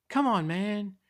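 high, loud, exasperated plea; loud whining appeal; pleading protest. A narrator's reading. Guy pleads with the bully.

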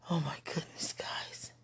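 trailing off into a whisper; faded whisper. Real, not acted. Telling viewers, voice fading to whisper. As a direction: let the word die to breath.

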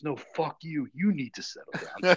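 high, loud comeback; imitated angry retort. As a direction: imitate the boss throwing it back, stressing YOU. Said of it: "So he told the medic".

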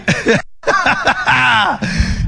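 real laughter; high laugh. Real, not acted. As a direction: laugh out at the crowd's attempt. Laughing at the accent game.

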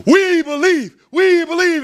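high loud chant; crowd shouting. Acted. Crowd shouting up to the tightrope walker: high loud chant. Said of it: "they're just shouting it out to him".

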